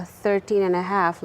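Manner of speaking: normal talking voice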